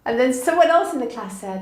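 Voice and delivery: ordinary delivery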